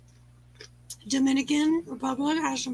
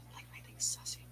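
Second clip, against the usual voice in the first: whispered aside; 20 dB quieter, much faster than usual. Real, not acted. Aside while eating on stream.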